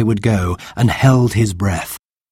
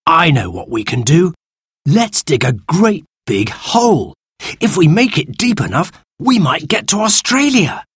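put-on eager boy's voice climbing higher as the plan grows; take-charge eldest boy; 7 semitones higher than usual. Eldest brother urges siblings to dig a hole, increasingly high and eager. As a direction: build the excitement like a boy with a big idea.

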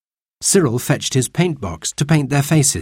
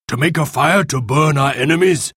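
raised, indignant chief's voice; angry; 4 semitones higher than usual. A narrator's reading. Golden Eagle rages at the lack of wood, angry. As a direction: demand it with indignation. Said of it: "Golden Eagle was angry".

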